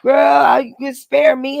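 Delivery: ordinary talk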